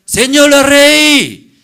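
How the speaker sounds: grito lento, agudo y fuerte; llamado al rey. Acted. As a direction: clama al rey alargando las palabras. La otra mujer se dirige al rey. (la mujer llama al rey a gritos)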